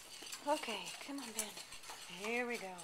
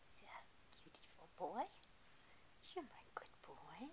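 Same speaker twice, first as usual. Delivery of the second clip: whispering; 4 semitones lower, 8 dB quieter than usual. Real, not acted. Whispering to sleepy dog.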